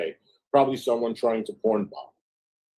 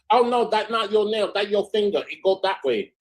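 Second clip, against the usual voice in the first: much higher, broken-English nail-tech impression; mock manicurist voice; 9 semitones higher than usual. Retelling the manicure joke as the nail technician, mock accented voice. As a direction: play the nail tech bluntly correcting her.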